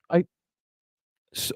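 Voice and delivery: ordinary talk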